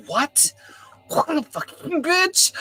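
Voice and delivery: disbelieving, rising high into an outraged curse; stunned outrage. Retold or imitated. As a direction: spit it out in shocked disbelief at his girlfriend. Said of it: "I was like".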